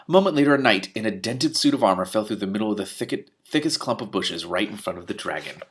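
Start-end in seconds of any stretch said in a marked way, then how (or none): none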